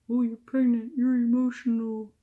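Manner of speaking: high, loud, sing-song mock of people's advice; mocking others. Mocking everyone who tells a pregnant woman this, high and loud. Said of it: "that's what everyone's fucking telling you".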